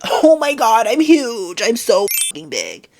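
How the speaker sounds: loud hammed-up whine; mocking skinny girl. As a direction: shriek it like a fake-dramatic diner. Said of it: "they're like".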